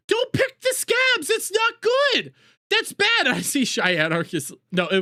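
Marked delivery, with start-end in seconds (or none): 0.1-3.3 much higher, slow, strained shout; shrill yelled plea. Real, not acted. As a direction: beg him to stop, laughing in disgust. Repeats shout, even higher.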